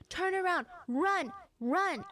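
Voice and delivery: high-pitched mock scream; retold screaming. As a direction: imitate a frantic warning cry. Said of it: "starts running towards her screaming".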